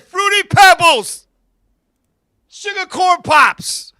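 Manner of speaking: loud, slow, eager answer; loud childlike excitement; eager shout. Retold or imitated. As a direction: shout it like a kid.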